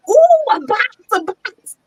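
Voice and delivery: put-on very high, excited little-kid squeal; excited toddler. Grandmother imitating toddlers spotting a box, excited. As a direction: imitate a thrilled small child, pitch way up. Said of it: "four-year-old get excited".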